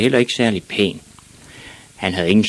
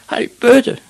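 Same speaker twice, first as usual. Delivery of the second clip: put-on very high, loud boy's shriek; shrill child's outburst; screamed; 7 dB louder than usual. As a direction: scream it back at the bullies. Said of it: "skreg Ivan".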